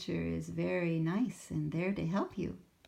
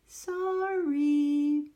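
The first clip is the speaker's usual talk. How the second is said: higher, louder embarrassed little voice; blushing apology; sheepish Sammy; 10 semitones higher, 8 dB louder than usual. Acted. Sammy apologizes to the teacher in a higher, embarrassed voice. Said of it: "blushed Sammy".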